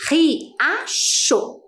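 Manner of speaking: "riacho" split into "ri, a, cho"; teacher sounding out each syllable of "riacho". Shown as a demonstration. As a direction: say "riacho" in three separate, evenly spaced syllables. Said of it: "nas sílabas".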